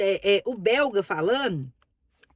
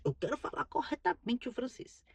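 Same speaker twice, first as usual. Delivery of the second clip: whispered inner resolve; hushed, to herself; 11 dB quieter than usual. Retold or imitated. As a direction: breathe it like a secret vow.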